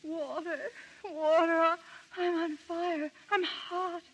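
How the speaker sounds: weak, very soft moaning; barely conscious, pleading; faint delirious. Acted.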